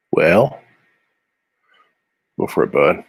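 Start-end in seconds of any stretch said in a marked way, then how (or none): none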